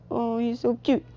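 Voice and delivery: high, soft coo; adoring coo. Acted. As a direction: coo softly over someone sleeping. Bendy cooing over sleeping brother.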